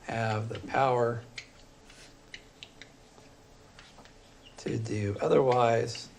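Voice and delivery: slow, measured, word by word, as if writing it on the board; slow dictation. Shown as a demonstration. Teacher writing notes for students, slow dictating. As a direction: spell out "Have the power to do otherwise" slowly, each word separate.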